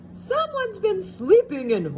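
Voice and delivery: high, too-light voice for Papa Bear; the false start, too high; wrong Papa Bear. Shown as a demonstration. Reading to children, first try at Papa Bear too high. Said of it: "let's try that again".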